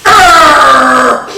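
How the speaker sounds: loud gruff roar of disgust; angry growl. Acted. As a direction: roar like a cross bear. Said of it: "He goes".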